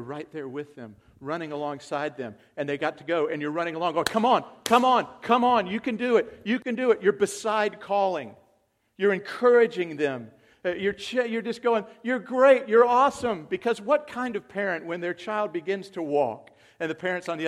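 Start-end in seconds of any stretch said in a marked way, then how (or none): 4.0-7.0 high, loud, running encouragement; excited parent cheering; cheering a child on. Retold or imitated. 12.0-13.5 high, loud gushing praise; excited parent praise; cheering praise. Retold or imitated.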